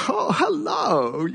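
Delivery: high, slow, overjoyed greeting; eager hello. Retold or imitated. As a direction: sing out a delighted hello to a fan. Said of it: "and I was like".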